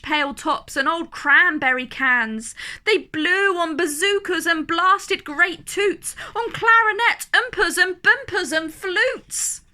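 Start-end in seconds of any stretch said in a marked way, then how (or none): none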